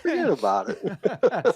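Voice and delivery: slow, high, exaggerated New York accent; New Yorker accent. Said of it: "in your best New Yorker accent".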